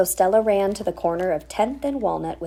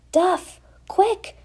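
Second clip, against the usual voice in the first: shout; 13 semitones higher, 5 dB louder than usual. Acted. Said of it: "shouted the scout leader".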